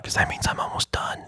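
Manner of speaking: unvoiced whisper, confiding; whispered aside. Real, not acted. Telling listeners his brothers are timing him, in a whisper.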